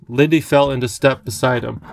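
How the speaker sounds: ordinary delivery, nothing marked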